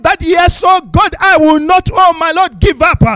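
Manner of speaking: loud, building prayer, rising to full force; fervent preaching prayer. Real, not acted.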